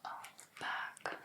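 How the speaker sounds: ordinary delivery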